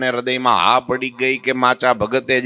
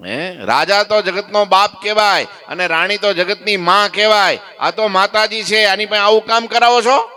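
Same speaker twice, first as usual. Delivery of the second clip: angry scolding; 6 semitones higher than usual. Retold or imitated. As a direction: lay into them, outraged. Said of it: "Maharaj ખીજાણા".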